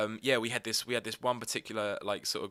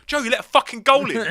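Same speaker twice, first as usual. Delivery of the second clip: mocking teammates; 13 semitones higher, 15 dB louder than usual. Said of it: "they were just like".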